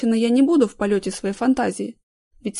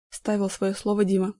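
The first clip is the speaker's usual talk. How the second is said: usual speaking voice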